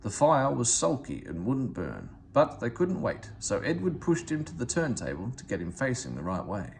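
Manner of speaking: ordinary delivery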